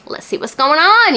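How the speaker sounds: eager. Retold or imitated. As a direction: perk up like the chapter is finally here. Quoting own eagerness for chapters.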